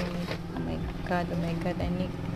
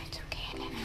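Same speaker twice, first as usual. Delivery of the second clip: whispered reassurance; 3 semitones higher, 13 dB quieter, much faster than usual. Real, not acted.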